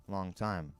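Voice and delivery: ordinary delivery, nothing marked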